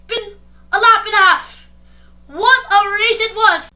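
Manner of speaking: loud, excited play-by-play call; race commentary. Acted. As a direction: call the race like a hyped caster.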